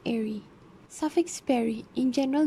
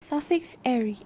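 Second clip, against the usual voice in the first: ordinary delivery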